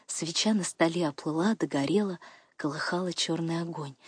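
plain reading voice

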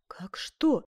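incredulous question with a loud finish; surprised. A narrator's reading. Duchess amazed at Ascanio's question, surprised. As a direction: echo it back in astonishment. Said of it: "удивилась герцогиня".

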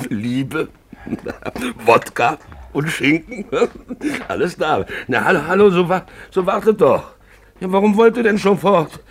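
high, loud cackling boast; laughing drunken boast. Acted. Drunk man bragging about his good life, laughing loudly. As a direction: crow it out between giggles.

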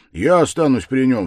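loud, high exclamation; crying out. A narrator's reading. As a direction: cry out a firm resolve. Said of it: "вскричал Разумихин".

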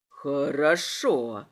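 very slow, low drawl; low menacing drawl; drawn-out. A narrator's reading. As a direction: stretch the word out in a low voice. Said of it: "протянул он".

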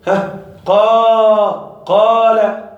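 قا stretched very slowly, open vowel from the throat; long alif demo. Shown as a demonstration. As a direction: draw out the ا in قال long and open. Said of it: "في الألف تقول".

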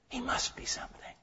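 "He must be something" breathed as a soft whisper; whispered echo. Shown as a demonstration. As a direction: drop to a hushed whisper of awe. Repeated line, then whispered echo.

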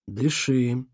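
gentle. Acted. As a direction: soothe him gently. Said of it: "мягко сказал Конрад".

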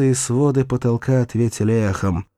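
plain reading voice

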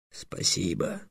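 soft, low gloomy thanks. A narrator's reading. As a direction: thank him grudgingly. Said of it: "мрачно сказал дракон". (мрачно и тихо)

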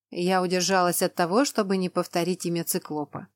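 plain reading voice

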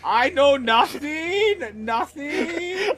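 put-on high, slow, shouted German accent; wailing hammy German guard; bad German accent. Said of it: "very bad German accents".

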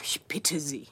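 indignant whisper. Acted. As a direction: hiss it in offended disbelief. Indignant lady, whispered aside. (empört geflüstert)

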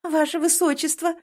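high, sweet-talking lilt; singsong. A narrator's reading. Girl bowing to His Highness, singsong. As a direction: sing out the title high. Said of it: "пропела".

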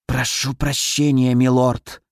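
slow, hissed apology in a serpent's voice; hissing snake. A narrator's reading. Fire snake begging her lord's pardon, hissing. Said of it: "прошипела она".

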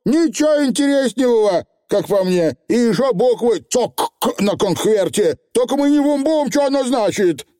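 high, chattering dialect voice; small rough-tongued creature puzzling aloud; excitable little Feegle. A narrator's reading. Feegle reporting on a letter, high and lively.

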